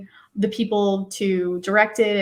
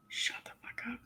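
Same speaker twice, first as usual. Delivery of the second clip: hushed, stunned disbelief; breathless whisper of disbelief; 2 semitones higher, 18 dB quieter than usual. Retold or imitated. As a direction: whisper it in shocked amazement.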